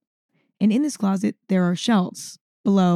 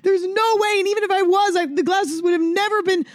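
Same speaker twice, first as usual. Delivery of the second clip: hysterical mom; 10 semitones higher than usual. Retold or imitated. Mom answers in hysterical scene.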